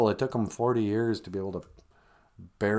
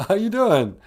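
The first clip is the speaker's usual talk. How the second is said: normal talking voice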